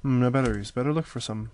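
ordinary talk, nothing marked

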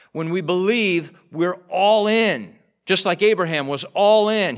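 preaching at full force. Real, not acted. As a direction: drive the words home to the congregation. Preaching climax.

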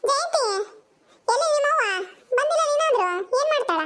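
calling out. Acted. Calling a girl from afar.

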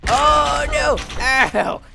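high, loud cartoon yell; mock alarm. Dad crashing in the game, mock alarmed yell. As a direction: cry out as if crashing.

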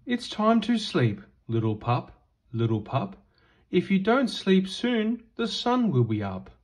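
usual speaking voice

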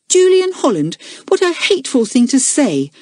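high-pitched indignant outburst. A narrator's reading. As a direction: protest in hurt outrage. Elizabeth indignant at Julian's tease.

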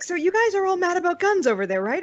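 high-pitched mock British questioner; butchered British accent. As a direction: ask it in a badly faked British accent. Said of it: "butchering a British accent".

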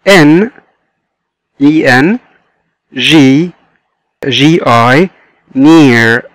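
"N، G، near" as isolated beats with long pauses; split into syllables. Shown as a demonstration. Teacher dividing engineer, each piece up to "near" said separately. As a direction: sound out en, gi, neer one at a time. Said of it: "لو حبينا نقسمها لsyllables".

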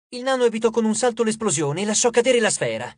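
usual speaking voice

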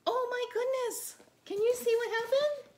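very high, excited exclamation; amazed. Acted. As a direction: gasp in delight for the kids. Amazed at reappearance.

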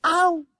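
high, loud "ow"; sudden yelp of a stung schoolboy; pained wail. A narrator's reading. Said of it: "wailed Derbyshire".

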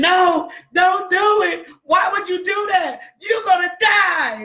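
high-pitched, alarmed warning cries; acted screaming. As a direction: yell like a frightened crewmate stopping him. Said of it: "if the other men are screaming at him".

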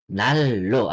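"nallo" low, with a held double L; correct assimilated pronunciation. Shown as a demonstration. Korean teacher repeating the right form, assimilated pronunciation. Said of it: "magiging ang pronunciation niya ay nallo".

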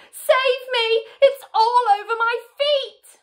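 high, loud, panicked plea; screamed. A narrator's reading. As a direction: shriek to be rescued in terror. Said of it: "screamed Missus Twit".

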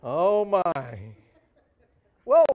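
amused aside after the joke; laughing. Real, not acted. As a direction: chuckle it out. Reacting to the joke.

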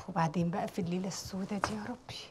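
low, soft muttered complaint; weary lament. Acted. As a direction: mutter it wearily to yourself. Doctor laments a hard night.

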